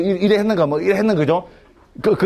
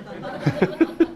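voiceless, soft chuckle; real chuckle; 4 semitones higher, 4 dB quieter than usual. Real, not acted. Lecturer joking about fleeing by another door, amused chuckle. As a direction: chuckle softly at your own joke. Laughs at his own joke.

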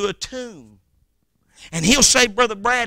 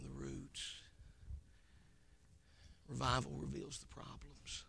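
much softer, intimate sermon voice; hushed preaching; 5 semitones lower, 16 dB quieter than usual. Acted. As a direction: draw them in, almost murmuring. Sudden hush in the sermon.

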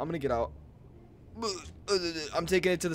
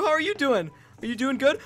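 usual speaking voice

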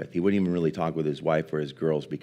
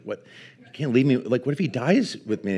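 replaying her own panic at being left alone; retold panic; 6 semitones higher than usual.

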